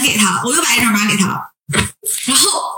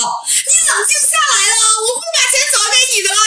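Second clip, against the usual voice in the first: coaxing scammer; 12 semitones higher than usual. Retold or imitated. Scammer soothing the crying student to get the code, high wheedling. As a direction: sweet-talk her into sending the code. Scammer calming the cursing victim.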